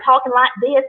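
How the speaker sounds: put-on Southern twang on the words; exaggerated Southern accent. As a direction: copy the Southern way of talking, overdone. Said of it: "a very exaggerated Southern accent".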